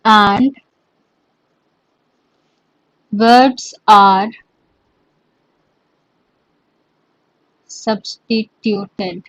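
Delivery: each word spaced out for notes; teacher dictating notes; slow dictation. Shown as a demonstration. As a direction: dictate it slowly so students can write.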